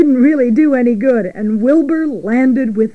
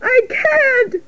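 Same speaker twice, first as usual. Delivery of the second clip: high piglet wail; frantic squeak; panicked cry. Acted. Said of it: "cried Wilbur".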